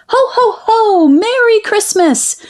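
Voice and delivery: high, cheery Santa-like ho ho ho; joyful cry. A narrator's reading. Family arriving at the door, calling out high and cheerful. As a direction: belt out a happy holiday greeting. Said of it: "cried Nicholas's grandparents".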